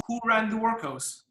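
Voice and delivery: ordinary talk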